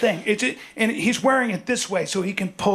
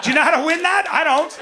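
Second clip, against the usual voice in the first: high, loud and rising; worked-up rant; 6 semitones higher, 3 dB louder than usual. Real, not acted. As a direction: rant it with growing alarm. Ranting about knife fights.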